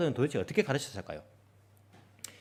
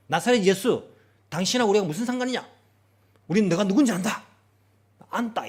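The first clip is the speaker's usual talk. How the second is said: louder, higher defiant shout; demon shouting; 5 semitones higher, 6 dB louder than usual. Acted. Demon shouting at Jesus in blunt speech. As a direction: cry out boldly at him. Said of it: "큰 목소리로 외치는 겁니다".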